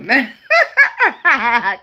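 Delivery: loud real laughter; laughing. Real, not acted. Laughing after joking about fighting. Laughing at her own remark.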